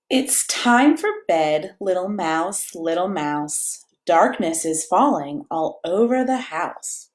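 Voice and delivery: slow, gentle bedtime read-aloud; cozy storybook reading; reading to children. A narrator's reading. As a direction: lull a toddler toward sleep. Reads picture book to child.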